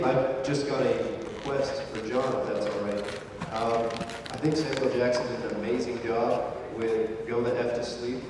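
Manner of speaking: ordinary delivery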